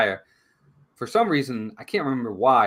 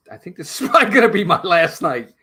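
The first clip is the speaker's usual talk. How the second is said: laughing through the quote; 6 semitones higher, 8 dB louder than usual. Retold or imitated. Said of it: "he's like".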